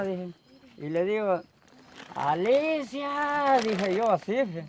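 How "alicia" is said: long drawn-out, higher-pitched call; retold call. Husband trapped among plants calls his wife, drawn-out retold shout. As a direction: imitate calling out slowly for a wife. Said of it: "Yo voy a gritar".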